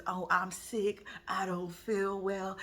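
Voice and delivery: slow, quiet fake-sick whine; mock sick excuse. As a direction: fake being ill, drawn out. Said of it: "playing games with this".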